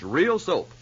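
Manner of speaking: ordinary talk, nothing marked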